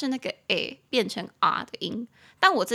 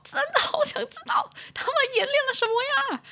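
very high, quick eager squeak; squeaky curious whine; faster than usual. Real, not acted.

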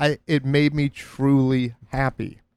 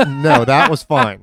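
laughing it off, louder and slower; 5 dB louder, slower than usual. Real, not acted. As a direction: brush it off with a laugh.

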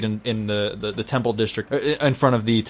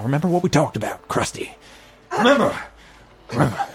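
[name]'s voice; 4 semitones higher, faster than usual. Acted. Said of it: "you hear [name], [name], uh, say something now".

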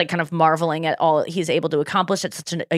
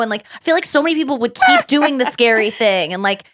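ordinary talk, nothing marked

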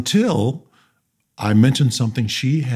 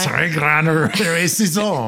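slow, high, hammy French; mock French accent; 5 semitones higher, much slower than usual. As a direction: draw it out in terrible French. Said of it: "With a terrible accent".

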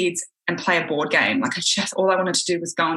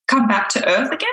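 ordinary talk

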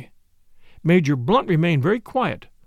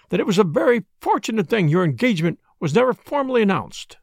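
high, fussy complaining; plaintive; 7 semitones higher than usual. A narrator's reading. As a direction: complain in a wounded, lilting tone. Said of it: "in a plaintive voice".

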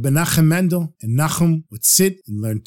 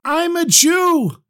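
retold shout; 10 semitones higher, much slower than usual. Prisoner recalling his urge to shout to the rabbi, a slow high mock shout. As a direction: stretch it out high like a held-back cry. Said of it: "I wanted to shout".

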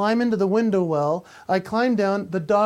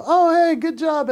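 higher, louder cheerful praise; praising the dog; 9 semitones higher, 7 dB louder than usual. Retold or imitated. Man praising the rescued pug, higher cheerful praise. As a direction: pat the pug on the head with your voice. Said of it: "And I go".

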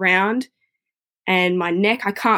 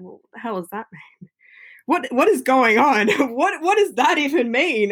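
incredulous disbelief; 5 semitones higher than usual. Retold or imitated. Retelling shocked reply to neurologist.

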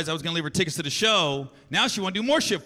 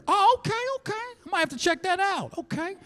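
high lady voice; 10 semitones higher than usual. Retold or imitated. Greeting Gary at the rental counter, high sweet voice. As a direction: play the friendly rental clerk, lifting way up. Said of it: "She goes".

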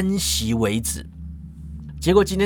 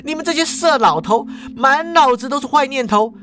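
high indignant rant, building to cries for witnesses; escalating high-pitched alarm; outraged accusation; 8 semitones higher than usual. Acted. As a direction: scold him, then cry out to everyone, higher still. Cashier accuses, calls out to onlookers.

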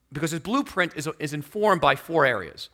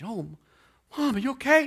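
put-on worried child; 8 semitones higher than usual. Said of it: "She's all worried".

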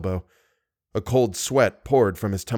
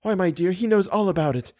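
lightly higher-pitched heroine voice; young woman character; 8 semitones higher than usual. A narrator's reading. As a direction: voice the heroine higher, answering her suitor. Christine answers Raoul in dialogue.

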